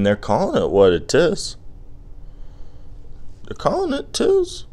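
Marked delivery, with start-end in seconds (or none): 3.5-4.6 high, put-on voice drawing out tis; mock high voice. Riffing on the rap lyric in a high put-on voice. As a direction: squeak the last word out long.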